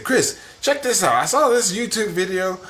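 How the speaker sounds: high, loud cartoon voice; squeaky cartoon dad; cartoon character voice. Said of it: "making him into a character".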